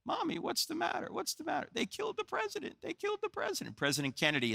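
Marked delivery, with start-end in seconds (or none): 0.0-1.7 put-on high, small voice of a two-year-old asking mommy; a toddler confused by his mother's tears; little child voice. Toddler asking his weeping mother what's wrong, high small child voice. 1.7-3.8 high, soft, broken voice of a grieving mother; mother weeping. Retold or imitated. Mother at the TV telling her little son, high soft grieving voice. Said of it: "weeping uncontrollably".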